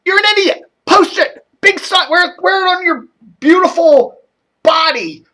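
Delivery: high-pitched exasperated rant at a pundit; mock rant. Host fake-yelling at a radio pundit, high exasperated mock rant. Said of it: "I go".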